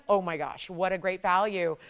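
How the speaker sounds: ordinary talk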